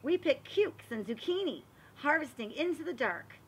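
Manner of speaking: storybook narration. Acted. Teacher reading a garden book aloud to the class, storybook voice. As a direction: read it like a picture book for little kids. Reads picture book to children.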